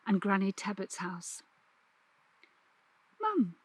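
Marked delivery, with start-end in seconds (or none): 3.2-3.5 calling mum. Acted. Girl calls to her mother.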